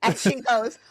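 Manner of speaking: ordinary delivery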